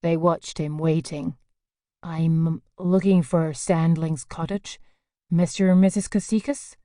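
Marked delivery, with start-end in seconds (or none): 2.0-6.8 lightly voiced American stranger, easy on the Greek name; American accent. American stranger asking locals the way, in a light American accent. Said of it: "unmistakably recognizable as American".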